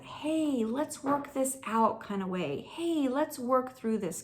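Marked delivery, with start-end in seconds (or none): 0.0-2.0 softer, slower, higher gentle invitation; gentle God. Retold or imitated. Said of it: "He is a gentle". 2.7-4.2 softer, slower, higher gentle invitation; gentle God. Retold or imitated. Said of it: "He is a gentle".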